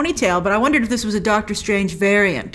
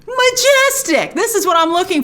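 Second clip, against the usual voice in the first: mock awe; 8 semitones higher than usual. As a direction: gush over the cape. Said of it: "and I'm like".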